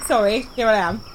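ordinary delivery

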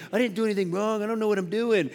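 high, soft put-on voice pleading innocence; defensive player. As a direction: mimic a clueless player making excuses. Retold excuse of the offending player.

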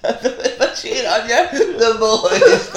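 mock groom vow. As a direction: offer a mock-solemn vow, amused. Continuing the mock wedding vows.

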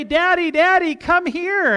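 high, loud imitation of excited little boys; eager child calling Dad. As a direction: call Daddy over like an eager kid. Voices his excited young sons.

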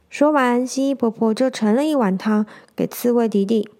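ordinary delivery, nothing marked

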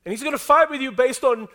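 preaching at full force. Acted. Preacher rallying the congregation, full-force preaching. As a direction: drive it home to the whole room.

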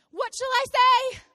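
high, loud exasperated question; hammed-up helpless appeal; pleading Moses. Moses asking God what to answer, pleading.

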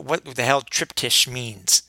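ordinary delivery

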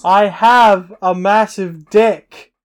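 slow, loud announcement, every word drawn out; deliberate loud yell. Shown as a demonstration. Podcaster testing whether she hears through headphones, slow loud yell. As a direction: yell it out slowly so the next room hears. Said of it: "I could just yell it really loud".

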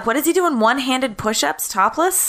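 ordinary talk

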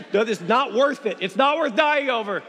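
ordinary delivery

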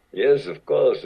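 high, loud, eager reply; mock eager yes. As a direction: jump at the chance. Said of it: "And they".

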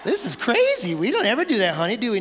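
high-pitched incredulous spouse; hammed-up denial to a spouse; mock disbelief. Voices a couple in mock denial.

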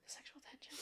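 sharp gasp, then a breathy whisper. Retold or imitated. Retelling her reaction to the movie, gasp then whisper. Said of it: "And I was like".

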